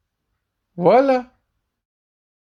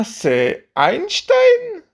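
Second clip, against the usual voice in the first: high slow voice; 12 semitones higher, much slower than usual. Acted. Comic name list voices.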